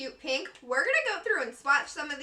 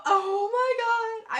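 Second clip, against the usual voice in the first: slow, high, teary oh my God; overwhelmed by a longed-for brand; emotional gasp; 9 semitones higher, 4 dB louder, much slower than usual. Real, not acted. Vlogger overwhelmed at receiving a dream brand, slow teary gasp. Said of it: "I'm fangirling a little bit too hard".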